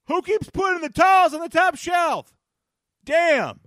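high, loud, exasperated yell; fed-up player hollering about towels; Tariq Cohen yelling. Retold or imitated. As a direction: shout it from across the locker room. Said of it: "you hear Tariq Cohen say".

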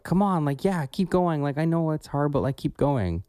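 high, pumped-up coach cheering you on; upbeat coaching voice. Retold or imitated. Imitating a motivating coach voice, high and eager. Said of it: "the coaching and motivating kind of voice".

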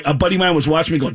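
usual speaking voice

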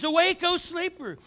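stretched-out loud summons; preached call. Acted. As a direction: thunder the command from the pulpit. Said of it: "He's telling us".

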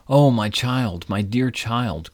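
slow, mild grief-stricken cry; grieving cry. Acted. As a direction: mourn the golden daughter, slowly. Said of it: "cried poor Midas".

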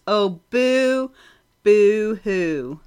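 mock boo-hoo wail; whiny pretend sobbing; fake crying. Mocking cry over lost programs.

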